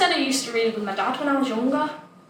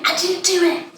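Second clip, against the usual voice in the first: slightly higher, mild plea; lightly voiced worried father; a touch of dad pleading; 6 semitones higher than usual. Child imitating dad repeating a mild plea. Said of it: "Like that".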